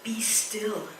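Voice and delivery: soft, quiet be still; Jesus calming the sea, softly; calm command. Retold or imitated. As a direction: hush the storm gently. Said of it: "he said".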